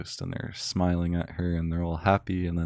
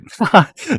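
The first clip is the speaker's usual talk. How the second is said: burst of laughter; 13 semitones higher, 11 dB louder than usual. Real, not acted. Laughs at own slip.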